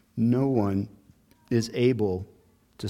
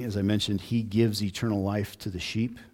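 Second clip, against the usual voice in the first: ordinary talk, nothing marked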